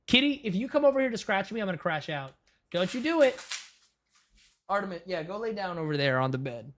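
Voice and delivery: quiet, quick warning to a pet; hushed scolding the cat. Real, not acted. Streamer hushes her cat off the keyboard, quiet scolding.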